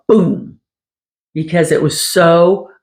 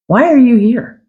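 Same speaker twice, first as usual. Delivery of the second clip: mock challenge, higher on the question; retold pointed question, pitched up; challenging question; 7 semitones higher, 4 dB louder than usual. Psychic reader questions why a client has come, challenging.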